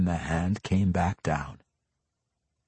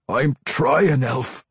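struggling dwarf, high and loud; strained effort to comply; Pwent; 7 semitones higher, 6 dB louder, slower than usual. A narrator's reading. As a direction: force it out as a tormented dwarf. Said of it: "he managed to mouth".

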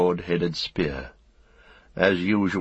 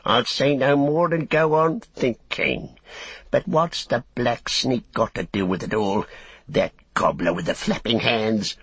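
orc tracker; 5 semitones higher than usual. Acted. Tracker grumbling.

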